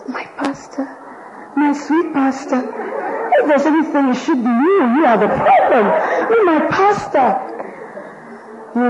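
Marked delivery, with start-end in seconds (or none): 0.1-7.4 high, fawning voice with laughter; doting church member. Retold or imitated. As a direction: gush over the pastor, laughing. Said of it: "The church member will look at".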